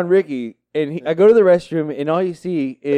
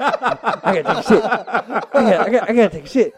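louder desperate repeat of "I gotta take a shit"; each time push it out louder and more urgent; getting louder; 5 semitones higher, faster than usual. Retold or imitated. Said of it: "saying it a little bit louder and louder".

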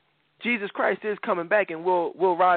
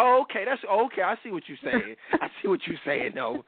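higher, sarcastic "oh, okay" brush-off; mock unconvinced; 6 semitones higher than usual. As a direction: play it like you don't buy a word. Mocking unconvinced reply.

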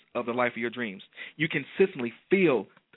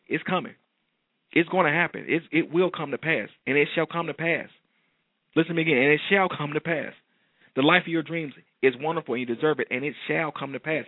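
raised, fervent sermon voice; preaching; 3 semitones higher than usual. Acted. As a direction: proclaim it like a preacher. Preaching at full force.

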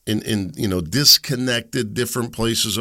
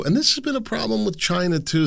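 ordinary talk

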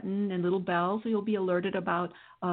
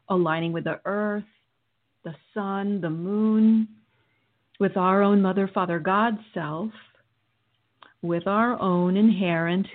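guided meditation. Acted. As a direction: guide them through calmly.

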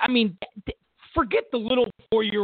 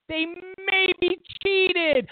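mock outrage; 10 semitones higher, much slower than usual. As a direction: ham up the horror at a maybe. Mocking Tom Brady outrage.

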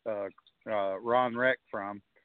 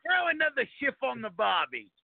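high hammy Aussie voice; squeaky mock Australian accent; 12 semitones higher than usual. Repeats Aussie catchphrase.

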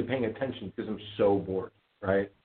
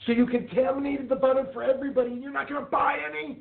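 mock indignant jab; 13 semitones higher than usual. Retold jab at the coughing woman.

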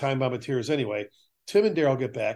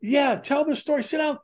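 much higher, fast excited pleading; eager rookie begging; 11 semitones higher than usual. Retold or imitated. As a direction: beg him to tell the old story again. Rookies urging retiree to stay.

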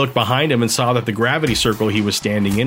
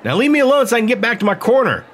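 high, fast, annoyed fighter; irritated snap; 10 semitones higher, faster than usual. A narrator's reading. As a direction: brush him off quickly. Fighter snapping at ref.

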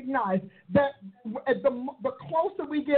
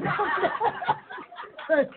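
laugh; 6 semitones higher than usual. Real, not acted.

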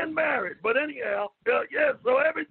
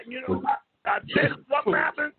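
ordinary talk

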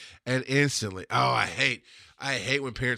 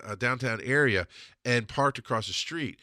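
usual speaking voice

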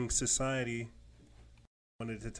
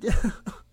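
nervous laugh; 10 semitones higher, 11 dB louder than usual. Real, not acted. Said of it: "when I laugh like that".

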